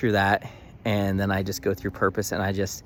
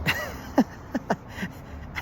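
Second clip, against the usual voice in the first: high breathy burst of laughter; delighted laugh; 14 semitones higher, 3 dB quieter than usual. Real, not acted. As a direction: squeal of disbelieving laughter. Laughing at client buying.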